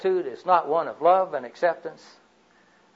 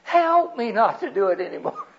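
put-on child's plea; 7 semitones higher, 5 dB louder than usual. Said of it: "when she cried out for help".

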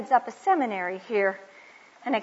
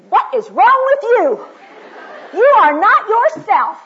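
mock husband; 13 semitones higher, 15 dB louder than usual. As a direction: ham up the baffled husband with a laugh. Husband voiced complaining.

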